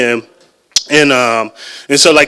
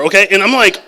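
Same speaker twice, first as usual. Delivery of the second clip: ordinary talk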